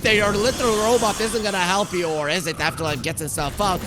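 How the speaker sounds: loud, high esports call building to a peak; live play-by-play. Real, not acted. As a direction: call the fight excitedly, rising to a shout. Live play-by-play of team fight.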